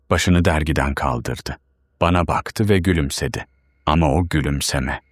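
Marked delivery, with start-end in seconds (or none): none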